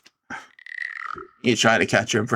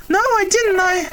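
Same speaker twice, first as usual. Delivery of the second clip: a much higher, pleading voice; desperate pleading character; 4 dB louder than usual. Acted. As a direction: beg as if about to cry. Character denies accusation.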